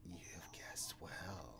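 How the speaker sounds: slow, soft ghost whisper; whispering spirit. Acted. Darth Zarana answers the master, whispered slowly. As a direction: concede it like a ghost. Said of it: "looking at her like".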